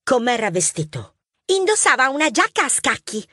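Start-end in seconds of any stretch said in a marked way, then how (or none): 1.5-3.3 put-on high child voice, eager retelling; little girl witness. Young mouse Mar describing the thief to police, high child voice. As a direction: tell it like a keen young witness.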